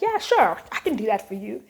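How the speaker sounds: overeager to please; eager people-pleaser. Retold or imitated. She cheerfully agrees to a coworker's request. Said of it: "I'll be like".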